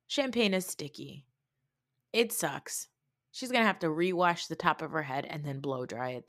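ordinary talk

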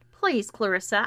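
ordinary delivery

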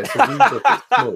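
high, loud mock British accent; hammed-up British accent. Host performs the British accent on request, high and loud. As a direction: ham it up like a posh Brit. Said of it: "say it".

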